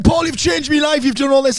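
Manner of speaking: high-pitched mock gushing fan. Audience member thanking comedian Paul after being put down, gushing. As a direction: imitate an overjoyed fan, pitched right up.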